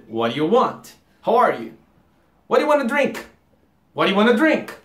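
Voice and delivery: quick, slurred into wanna; fast casual English. Shown as a demonstration. Teacher showing natural fast speech. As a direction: rush it like a native. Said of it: "Que seria".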